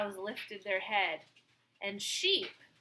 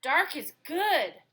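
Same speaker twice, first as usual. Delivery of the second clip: told them; 6 semitones higher, 4 dB louder than usual. Acted. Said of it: "Dog told them".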